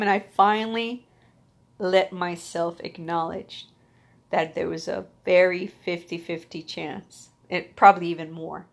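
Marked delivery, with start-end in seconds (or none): none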